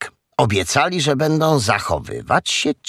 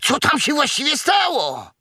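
very high, squealed question; alarmed piglet. Acted. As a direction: cry out the question as a shocked piglet.

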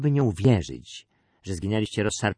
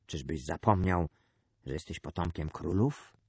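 lower, softer, grave calm; hushed, dreadfully calm father; terrible calm; 4 semitones lower, 5 dB quieter than usual. A narrator's reading. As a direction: answer the outcry with sudden cold composure. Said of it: "z nagłym, straszliwym spokojem".

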